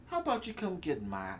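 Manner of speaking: quietly scheming devil; a hint of a tempting devil; sly devil voice. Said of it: "if I were the devil".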